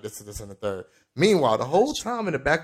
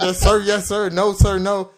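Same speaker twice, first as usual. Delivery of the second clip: flustered recruit; 8 semitones higher, 6 dB louder than usual. Retold or imitated. Flustered recruit babbling answers.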